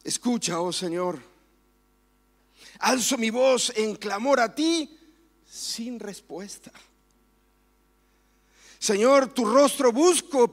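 slow, loud, pleading scripture reading with long pauses; impassioned psalm reading. Acted. Pastor reads David's lament to the congregation, fervent and pleading. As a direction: cry the psalm out to God, drawing each line out.

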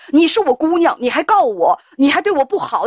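a hint of a furious parent's rant; mock angry mother. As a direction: play the unhinged mother scolding her daughter. Mother's threats, voiced by host.

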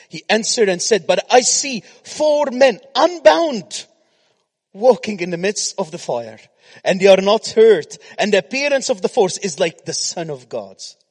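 loud, high scripture reading; preaching. Acted. Preacher reading the fiery furnace verse to the congregation, loud preaching.

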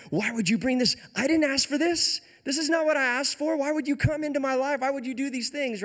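raging widow. Retold or imitated. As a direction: hurl the blame at him in grief. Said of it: "She's raging on Elijah".

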